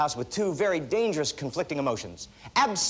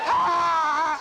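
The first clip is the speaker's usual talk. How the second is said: high, loud mock war cry; a silly charging scream, laughing; mock battle yell; 13 semitones higher, 5 dB louder than usual. Naked husband charging at burglars, high laughing battle cry.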